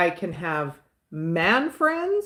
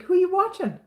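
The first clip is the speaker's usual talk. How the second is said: higher, teasing voice; teasing question; 10 semitones higher than usual. Retold or imitated. Husband teasing her about watching Steven, playful. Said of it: "he's like".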